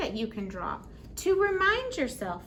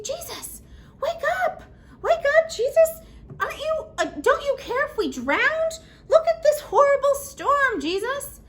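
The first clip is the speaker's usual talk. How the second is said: much higher, louder frantic cry for help; panicked plea; 14 semitones higher, 8 dB louder than usual. Acted. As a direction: shake him awake in the storm. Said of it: "and they say".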